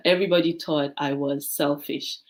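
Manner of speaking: ordinary talk, nothing marked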